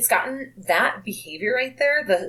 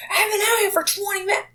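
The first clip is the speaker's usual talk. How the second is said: whiny customer; 12 semitones higher than usual. Retold or imitated. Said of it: "they go".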